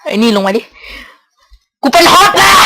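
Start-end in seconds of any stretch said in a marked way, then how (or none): 1.8-2.5 higher-pitched triumphant cry; gleeful gloat. Real, not acted. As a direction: crow about the win. Gloating after getting hot. (ตะโกนอวดอย่างดีใจ)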